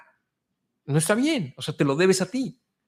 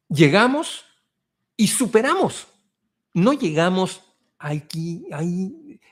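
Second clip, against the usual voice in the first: higher and louder, triumphant; proud rallying cry; 4 semitones higher, 3 dB louder, slower than usual. Real, not acted. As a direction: declare it like a victory. Rallying immigrants, proud declaration.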